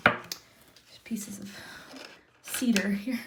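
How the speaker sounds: slow unvoiced whisper. Real, not acted. Tarot reader noticing cedar in the charms, whispered. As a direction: murmur it as a hushed aside. Whispering aside during reading.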